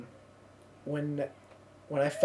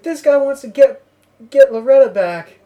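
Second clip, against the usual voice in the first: loud, raised showman's voice; imitated stage announcement; 9 semitones higher, 14 dB louder than usual. Singer calling out a fan from stage, loud retold announcement. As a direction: announce it to the whole crowd.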